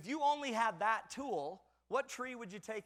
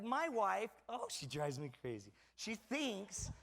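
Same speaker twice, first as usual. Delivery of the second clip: normal talking voice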